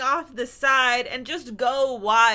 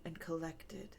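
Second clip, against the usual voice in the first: mock-composed whisper, much softer; hushed calm whisper; 5 semitones lower, 16 dB quieter than usual. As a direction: whisper it like a cool-headed schemer. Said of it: "presented as like".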